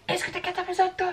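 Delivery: high, teasing sing-out; mock-gloating high voice; playful taunt. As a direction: taunt a friend in a squeaky high pitch. Playfully boasting to an imagined listener.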